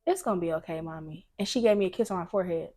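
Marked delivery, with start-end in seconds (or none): 0.1-1.3 softer, lower comforting little voice; soft reassuring child. Retold or imitated. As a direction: soothe mommy gently, very soft. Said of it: "She like".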